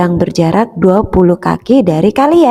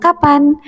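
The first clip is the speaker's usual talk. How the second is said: "Kapan?" pitched high as a question; question tune; 6 semitones higher than usual. Shown as a demonstration. Teacher models the question word for pupils, high asking tune. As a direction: lift "Kapan?" like asking a child. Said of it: "dibaca dengan intonasi yang tepat".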